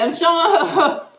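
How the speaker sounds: high laugh through the words. Real, not acted. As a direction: giggle as you say it. Laughing about paying.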